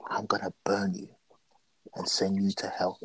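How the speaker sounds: hushed, slow, menacing whisper; slow whispered threat. Retold or imitated. Randy Orton threatening Alexa before lighting the match, slow whisper. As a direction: breathe the threat out slowly under your breath. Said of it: "Says it slowly".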